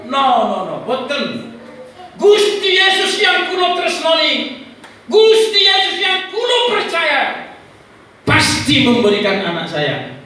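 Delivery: high, loud, drawn-out proclamation of faith; defiant declaration. Retold or imitated. Father answering the devil with faith, defiant declaration. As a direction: refuse firmly, then proclaim at full voice.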